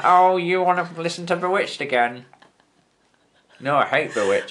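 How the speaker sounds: lightly voiced northern English nurse; a hint of a chirpy northern nurse; northern nurse accent. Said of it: "my generic northern nurse accent".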